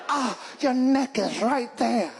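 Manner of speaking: slow, savoring murderous cat; predator cat voice. Acted. Cat eyeing the squirrel's neck, slow and hungry. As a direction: purr it out like a killer. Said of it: "it's like".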